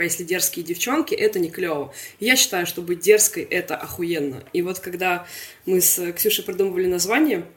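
ordinary talk

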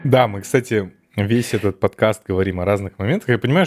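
ordinary delivery

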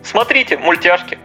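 normal talking voice

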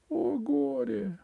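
high, slow lament; grieving cry. A narrator's reading. A voice mourning the empress, high lament. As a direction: cry out in grief. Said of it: "послышался чей-то голос".

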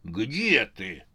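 puzzled question in a put-on voice; mildly taken aback, searching for someone; surprised. A narrator's reading. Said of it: "удивился Велес". (удивлённо)

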